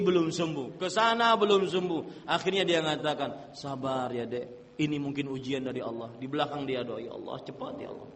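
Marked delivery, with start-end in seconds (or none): 3.5-6.1 soft, hushed mock sympathy; a husband faking gentle patience, very soft; mock tender husband. Husband feigning gentle comfort. 7.0-8.1 hushed, sly mock prayer under the breath; husband secretly praying for his sick wife's death, hushed; secret muttered prayer.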